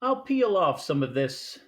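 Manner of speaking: ordinary delivery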